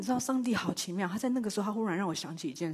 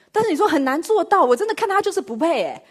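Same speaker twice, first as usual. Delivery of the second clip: protesting objection; 9 semitones higher, 10 dB louder than usual. Retold or imitated. Voicing a listener's objection.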